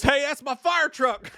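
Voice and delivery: high, stretched-out fireman holler; imitated outraged yell; mock yell. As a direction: call out slowly in a high, hammed-up shout. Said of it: "Fireman yells".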